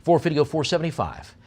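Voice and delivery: brisk auction chant, pushing for bids; auctioneer bid call. Real, not acted.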